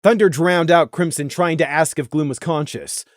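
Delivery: usual speaking voice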